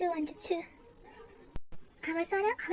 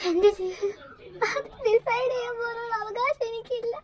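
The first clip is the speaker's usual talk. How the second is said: crying; 6 semitones higher, slower than usual. Acted. Girl weeping over forced marriage.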